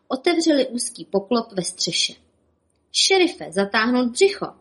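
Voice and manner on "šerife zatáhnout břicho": high, brisk order; commanding. Acted. Bob ordering the sheriff to suck in his belly, commanding. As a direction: bark it like a drill command. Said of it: "zavelel Bob".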